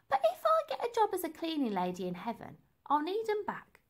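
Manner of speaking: high cheeky lady. Acted. Lady's cleaning-lady joke.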